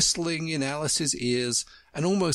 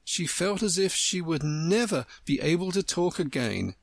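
ordinary delivery, nothing marked

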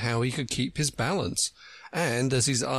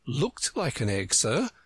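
Alice explaining gently, pitched higher; gentle explanation; 8 semitones higher than usual. A narrator's reading. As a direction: soothe him, lightly and up high. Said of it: "Alice gently explained".

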